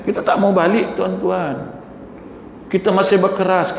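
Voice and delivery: ordinary talk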